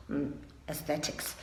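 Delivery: whisper-soft "aesthetics", trying it again; quietly mouthing "aesthetics"; mumbled retry. Shown as a demonstration. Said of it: "That word is very difficult to pronounce".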